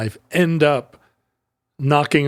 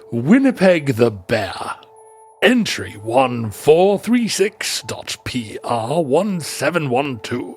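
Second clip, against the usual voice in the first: put-on British accent; slower than usual. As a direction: announce the entry like a proper Briton. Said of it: "do it in a British accent".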